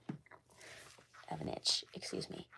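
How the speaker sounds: hushed, voiceless apology; whispered aside. Real, not acted. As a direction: whisper it under your breath. Apologizes for an itch.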